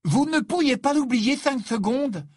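high, loud and indignant; angry outburst. A narrator's reading. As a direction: exclaim it in exasperated anger. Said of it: "s'exclamer avec colère".

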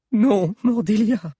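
high, loud, slow plea; pleading. Acted. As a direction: beg her to stop. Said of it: "supplia l'historien". (supplication aiguë et lente)

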